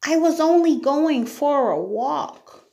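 put-on high-pitched innocent girl's voice; small, high storybook girl; timid Sarah, pleading. A narrator's reading. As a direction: explain yourself meekly, pitched right up.

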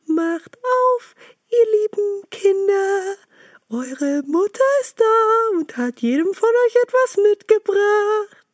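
the wolf squeaking high like a gentle mother goat; wolf's fine chalk voice. Acted. Wolf at the door imitating the mother, high and sweet. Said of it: "machte damit seine Stimme fein".

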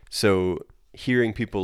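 usual speaking voice

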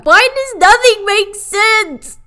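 high, loud, drawn-out comic wail; exasperated yell. Real, not acted. Reviewer baffled by the show's logic, exasperated wail. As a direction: wail it in hammed-up despair. Exasperated cry at plot absurdity.

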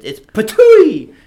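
high, slow spitting effect; mock spit sound.

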